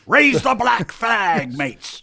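hammed-up Sean Connery pirate shout, slow and high; Scottish pirate accent. Co-host hams a Sean Connery pirate line: slow hammed-up Scottish shout. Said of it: "I'm Sean Connery".

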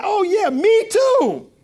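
high, very slow mock sigh of me too; mock eager follower. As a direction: drag it out like a sheep joining the crowd. Mocking a follower joining in.

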